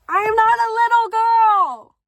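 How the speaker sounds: high, loud, offended cry; mock indignant shout. Correcting herself after saying little girl, a high mock shout. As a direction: bellow the denial in a put-on voice.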